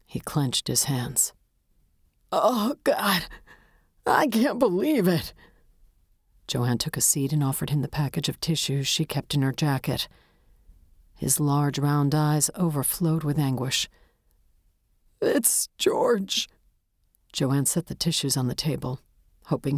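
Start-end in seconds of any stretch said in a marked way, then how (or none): 2.3-5.3 put-on high, tearful old man's wail; anguished. A narrator's reading. Widower in disbelief at his wife's death, anguished and tearful. Widower grieving in narrated story. 15.2-16.5 put-on high, breaking old man's voice; anguished. A narrator's reading. Widower gives the detective his name, anguished.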